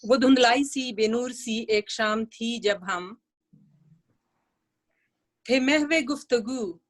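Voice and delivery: measured poetry reading with long pauses; unhurried, expressive verse delivery; nazm recitation. Acted. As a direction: recite the poem phrase by phrase, pausing between them.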